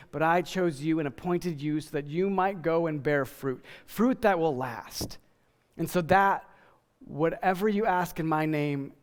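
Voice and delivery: normal talking voice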